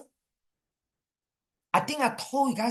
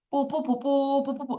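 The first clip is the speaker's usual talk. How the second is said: imitated nobat fanfare; 6 semitones higher than usual. Shown as a demonstration. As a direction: toot as the procession passes. Imitates the fanfare as king passes.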